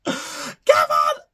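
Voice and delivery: high shrieking shout of a name; mock scream. Mom realizing Kevin is missing, shrieking.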